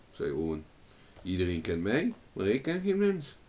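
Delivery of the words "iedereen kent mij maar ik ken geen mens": level start, much higher second half; puzzled reply. A narrator's reading. Owen answers the crane, pitch leaping up. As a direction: lift the pitch sharply on the second clause.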